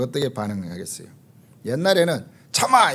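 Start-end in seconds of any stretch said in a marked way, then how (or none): 2.5-2.9 a higher, sharp command; mock stern order. Pastor imitating how he used to scold his upset wife, mock stern order. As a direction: bark it at her like a bossy husband. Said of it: "이렇게 했는데".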